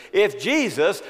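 "if Jesus" shouted high and loud with a rising lift; proclaimed if. Shown as a demonstration. Preacher crying the question to the church, ringing proclamation. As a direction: call out "if Jesus" to the back row. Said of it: "to ask if Jesus".

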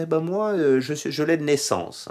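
high, pointed ironic retort; ironic reply. Retold or imitated. Paul answering the tribune with irony, pitched high. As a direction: toss it back with a touch of irony. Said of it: "vous voyez toute l'ironie".

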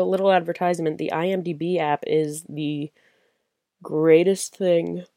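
ordinary talk

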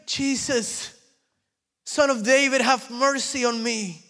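high, loud cry for mercy; pleading cry. Retold or imitated. Blind man cries out to Jesus for mercy, high and loud. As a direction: call out desperately.

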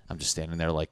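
ordinary talk

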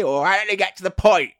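high mocking voice; mocking imitation. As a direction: parody the co-host. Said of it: "You always say".

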